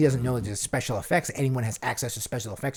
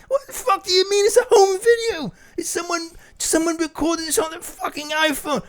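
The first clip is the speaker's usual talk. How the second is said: high, loud, wailing [name]; [name] impression; 4 dB louder than usual. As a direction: cry out baffled like [name]. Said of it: "play all three parts".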